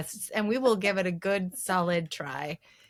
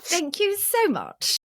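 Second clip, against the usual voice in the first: high, slow exaggerated British accent; 9 semitones higher, slower than usual. Co-host echoing winner's thanks, exaggerated British accent. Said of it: "you have to do the British accent".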